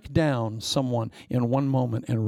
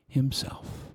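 whisper; 6 dB quieter than usual. Acted. Dramatic ending word.

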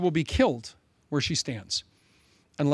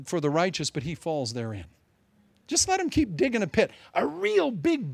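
ordinary delivery

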